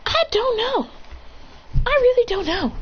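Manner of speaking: very high-pitched character voice; clueless reply. Acted. Madara answering the question, clueless. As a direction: pitch it way up, falling at the end.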